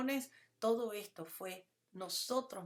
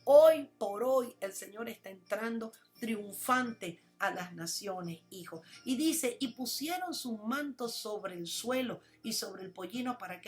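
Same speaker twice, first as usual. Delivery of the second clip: loud, rousing sermon delivery; full-voiced pulpit preaching; impassioned preaching; 7 dB louder than usual. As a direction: preach it to the congregation with fire. Preaching with scripture reading.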